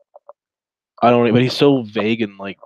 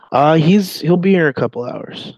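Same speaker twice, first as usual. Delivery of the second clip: decoy voice; 6 semitones higher, 6 dB louder than usual. Acted. Role-playing decoy reply.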